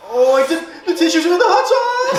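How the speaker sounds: high panicked laughing shout; frantic. Real, not acted. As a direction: yell for help mid-burn. Burning mouth, calling for tissues.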